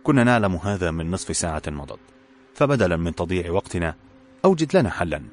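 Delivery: ordinary delivery, nothing marked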